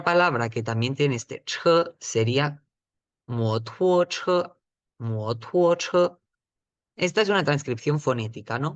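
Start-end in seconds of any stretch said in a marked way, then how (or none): 3.3-6.1 motuoche modeled higher, carefully with Mandarin tones; Mandarin word motuoche. Shown as a demonstration. Teacher repeats motuoche for students to copy. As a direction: say motuoche twice, clearly for learners.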